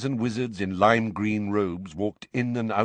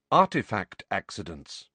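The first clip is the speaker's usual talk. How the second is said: ordinary delivery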